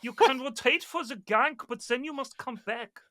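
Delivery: high-pitched, put-on German accent. German sniper instructing Witch Doctor to return, German accent. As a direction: lecture like a German teammate, accent on. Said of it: "He was German".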